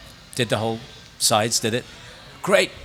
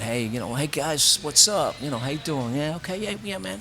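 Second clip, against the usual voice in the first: put-on laid-back American accent, higher and mostly soft; easygoing higher-pitched American accent, casual and loose; 6 semitones higher, 3 dB quieter than usual. Irish actor bluffing casting directors at an audition, American accent. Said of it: "So I walked in as an American".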